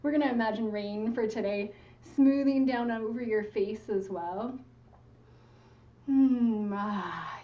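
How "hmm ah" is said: sighing hum. Shown as a demonstration. Meditation guide demonstrates letting out a sound, relieved falling sigh. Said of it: "let out a sigh or a sound".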